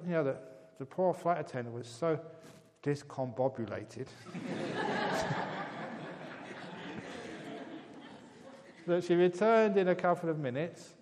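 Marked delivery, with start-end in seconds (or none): 4.1-8.8 long breathy laugh, pitched up; long airy laugh. Real, not acted. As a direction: laugh helplessly at the story. Laughing at own joke.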